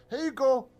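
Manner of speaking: high, loud cheerful goblin offering teeth. Acted. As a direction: proudly hand over your spat-out teeth. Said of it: "and go".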